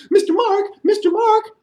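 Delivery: very high bat voice; squeaky bat. Acted. Bat flying down calling to the storyteller in a high squeak. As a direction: chirp it like a small bat.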